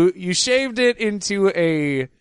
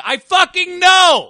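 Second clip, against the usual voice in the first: yelled catchphrase; 12 semitones higher, 6 dB louder, slower than usual. Retold or imitated. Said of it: "a recording of me saying".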